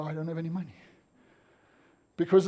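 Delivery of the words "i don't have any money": low, soft brush-off; mumbled refusal. Acted. Driver refusing a beggar at a stop street, quietly dismissive. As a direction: turn them away under your breath.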